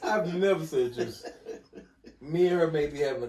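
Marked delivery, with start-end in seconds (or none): 0.0-1.3 louder, laughing insistence; laughing denial. Real, not acted. Friend denies misnaming his wife to his teasing host, laughing. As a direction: protest through open laughter.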